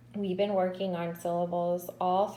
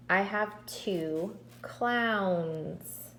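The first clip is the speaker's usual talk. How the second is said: slow, drawn-out clowns with the final S sounded; stressing the plural; much slower than usual. Shown as a demonstration. Teacher showing the plural S to the class, slowly. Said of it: "So I'm going to add an S".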